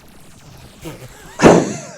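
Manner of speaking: sudden loud high laugh; loud burst of amused laughter; real laugh. Real, not acted. Reacts to played comedy clip.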